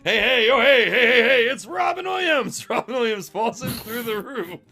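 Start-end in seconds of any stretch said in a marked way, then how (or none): 0.0-2.7 loud, higher excited cheering; hyped announcement. Acted. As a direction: pump up the crowd.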